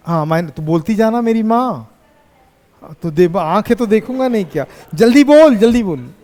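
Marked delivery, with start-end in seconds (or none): 4.9-5.5 higher, louder impatient push; teacher loudly urging a student to answer. Real, not acted. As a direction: hurry her up with a raised voice.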